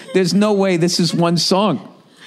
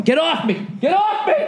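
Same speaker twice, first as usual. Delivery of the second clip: mock panicked protest; 13 semitones higher than usual. Retelling a guy dragged by Target staff, mock panic. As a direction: imitate a man being grabbed, pitch jumping higher. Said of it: "I just hear this guy going".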